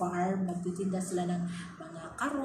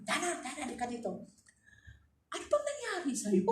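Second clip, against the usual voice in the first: employer mother calling; 8 semitones higher than usual. Retold or imitated. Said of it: "Sabi niya".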